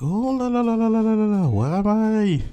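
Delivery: high singsong, bewildered sigh of wonder; amazed oh la la. Retold or imitated. Overwhelmed at the welcome party, high and singsong.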